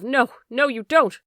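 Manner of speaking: high, loud cry of protest; the vicar cries out in a struggle, loud and high; sharp cry. A narrator's reading. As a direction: cry out to stop someone. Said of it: "a sharp cry of".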